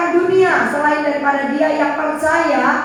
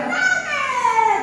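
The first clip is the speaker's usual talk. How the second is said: high mock child's wail of pain; child's cry of pain; 9 semitones higher than usual. Mother imitates her son crying out at the spanking, high-pitched wail. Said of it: "teriak lagi".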